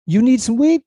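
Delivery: put-on high, loud, surprised African accent; the driver's amused African-accented question. Uber driver asks about weed, high surprised African accent. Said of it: "I'll probably do a little bit of it".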